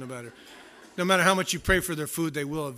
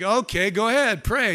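exasperated; 5 semitones higher, 7 dB louder than usual. Retold or imitated. Exasperated husband to wife.

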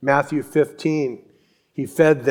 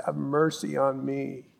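soft, understated plea; retold cry, hushed; 10 dB quieter than usual. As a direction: say the cry softly, as if remembering it. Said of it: "Bartimaeus crying out in his rags".

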